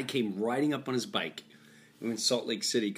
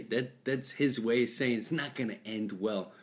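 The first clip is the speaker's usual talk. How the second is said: normal talking voice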